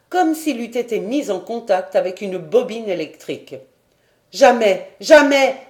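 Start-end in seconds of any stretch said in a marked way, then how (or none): 4.3-4.9 first refusal, raised and loud; cry out. Acted. Paganel refusing the major's examination, crying out. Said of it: "s'écria-t-il". 5.0-5.6 second refusal, higher and even louder; full shout. Acted. Paganel refusing the major's examination, shouting louder. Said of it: "s'écria-t-il".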